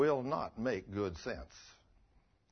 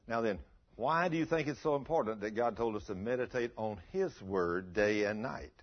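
ordinary talk

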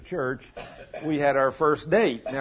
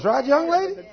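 playful call-out; 13 semitones higher, 6 dB louder than usual. Real, not acted. Preacher calls out to listener.

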